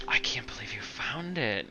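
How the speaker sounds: hushed unvoiced whisper; soft secret whisper. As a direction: confide it in a whisper. Anders alone, confiding.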